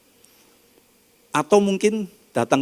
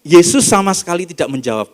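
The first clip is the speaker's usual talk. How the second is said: loud, weighty pronouncement; preacher stressing that Jesus did not answer; emphatic declaration; 5 semitones higher, 8 dB louder than usual. Acted. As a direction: land the line hard on the congregation. Emphatic line of the sermon.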